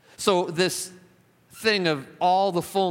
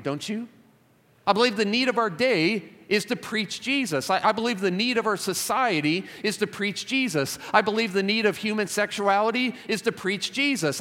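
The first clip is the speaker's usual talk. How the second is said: higher-pitched, urgent sermon build; impassioned preaching; 4 semitones higher than usual. Real, not acted. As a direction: drive the refrain home like a fervent preacher. Preaches at rising intensity.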